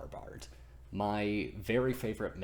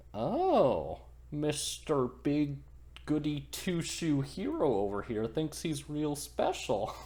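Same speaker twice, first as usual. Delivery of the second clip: higher sneering ferryman voice; mocking ferryman; 6 semitones higher than usual. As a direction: sneer at the hero like a lazy ferryman. Sleepy ferryman mocks Thor.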